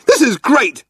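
high, loud sarcastic outburst; spluttering. A narrator's reading. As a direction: splutter it in outrage. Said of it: "spluttered Arthur".

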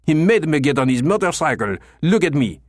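raging. Acted. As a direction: rage at the injustice done to him. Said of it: "Clochard was raging".

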